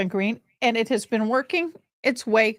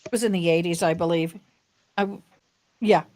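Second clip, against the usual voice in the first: ordinary talk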